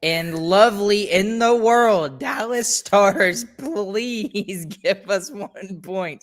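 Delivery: hammed-up begging, stretching the words out slowly; mock desperate begging. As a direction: plead as if everything depends on it.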